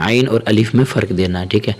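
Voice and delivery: normal talking voice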